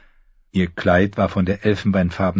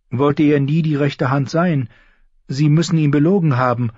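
higher, earnest plea for mercy; pleading girl; 7 semitones higher than usual. A narrator's reading. Sansa pleads before the king, earnestly. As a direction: beg the court for your father.

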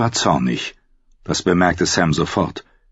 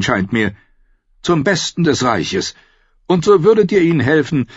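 higher, eager fawning voice; wheedling courtier; 7 semitones higher than usual. A narrator's reading. Janos urges Stannis to steer the vote, wheedling higher. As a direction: coax the king to take control.

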